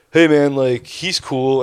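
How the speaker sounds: smooth it over, man; placating buddy. Retold or imitated.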